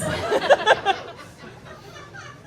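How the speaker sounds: high delighted giggle; high laugh. Real, not acted. Laugh at own joke.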